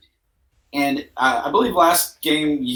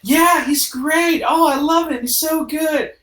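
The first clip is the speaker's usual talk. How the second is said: excited clubgoer; slower than usual. Acted. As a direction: gush like an eager partygoer. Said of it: "you do get a couple of".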